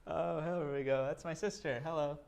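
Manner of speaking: usual speaking voice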